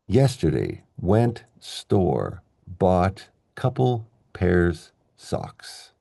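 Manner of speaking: slow telegram-style delivery; slow, word by word. Shown as a demonstration. Teacher demonstrates content words, said slowly word by word. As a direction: set each content word apart, slowly.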